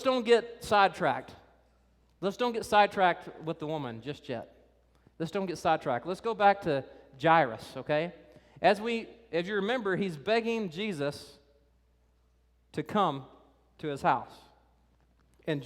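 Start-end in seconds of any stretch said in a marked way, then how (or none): none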